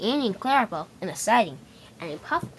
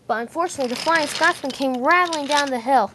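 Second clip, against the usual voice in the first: ordinary delivery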